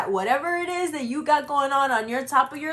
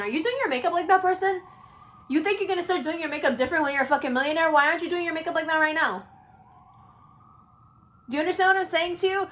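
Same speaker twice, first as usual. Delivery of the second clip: higher and fast, pushing hard; fired-up rant; 6 semitones higher, much faster than usual. Real, not acted. Astrologer scolding viewers about makeup, higher, fast and fired up. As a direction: jab the questions at them quickly, rising up.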